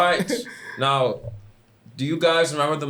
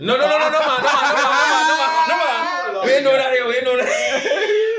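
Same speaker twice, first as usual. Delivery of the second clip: laughing protest; 9 semitones higher, 3 dB louder than usual. Real, not acted.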